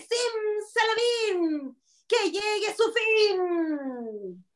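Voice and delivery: high, slowly chanted incantation; witch's spell. Acted. Storyteller leading children in the witch's curse, drawn-out chant. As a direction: stretch the spell out long and high. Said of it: "A ver, de nuevo".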